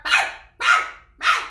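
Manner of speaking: sharp, high, loud barks; loud dog bark. Acted. Oscar the dog going to the rescue, loud barking. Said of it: "with a loud bark".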